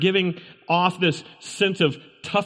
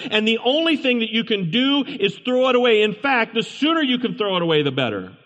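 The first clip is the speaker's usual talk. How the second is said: raised, driving sermon voice, higher and insistent; preaching at full force; 7 semitones higher, 4 dB louder than usual. Acted. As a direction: preach it hard to the congregation. Preaching about the rotten fruit.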